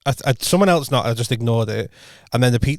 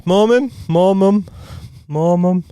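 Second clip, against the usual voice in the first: slow, higher, louder mock kid call; imitating a child pestering mum; 5 semitones higher, 4 dB louder, slower than usual. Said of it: "when I was a kid".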